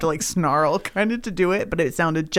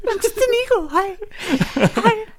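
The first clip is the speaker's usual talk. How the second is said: tiny eagle voice; 13 semitones higher than usual. Pretend. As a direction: chirp a shy hello as an eagle. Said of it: "They're just like".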